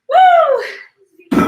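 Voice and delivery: high, loud victory cheer; excited whoop. Real, not acted. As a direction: whoop like you just finished. Celebrating the finished workout.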